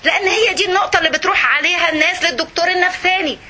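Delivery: usual speaking voice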